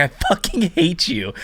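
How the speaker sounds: said through a laugh; joking insult between friends; laughing mock hate. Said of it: "He's like".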